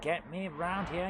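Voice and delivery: usual speaking voice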